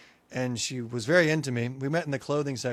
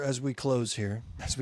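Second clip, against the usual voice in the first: preacher voice; 3 semitones lower, 5 dB quieter than usual. Pretend. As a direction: intone it like a pastor wrapping up. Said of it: "what am I preaching?".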